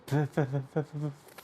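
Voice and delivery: low, fading stutter; stuttered repetition. A narrator's reading. Narrator telling how all scattered like a mad storm, fading stutter.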